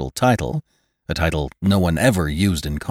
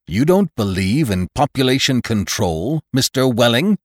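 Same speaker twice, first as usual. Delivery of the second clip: severe, louder and higher challenge; 8 semitones higher, 7 dB louder than usual. A narrator's reading. Doctor challenges the reluctant father, severely. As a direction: tower over the listener with a stern, raised question. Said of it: "became rather severe with Welling".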